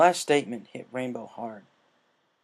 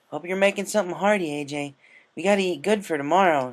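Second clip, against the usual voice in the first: higher, eager and cheerful; happily; 7 semitones higher, 5 dB louder than usual. Acted. Rainbow greeting Applejack at breakfast, happily. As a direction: bounce in with good spirits. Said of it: "she said happily".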